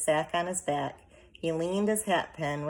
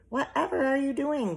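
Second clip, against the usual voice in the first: grumbling; 11 semitones higher, 4 dB louder than usual. A narrator's reading. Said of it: "grumbled Homily".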